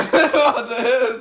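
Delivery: can barely speak for laughing. Real, not acted. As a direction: burst out laughing mid-word. Cracks up mid-sentence.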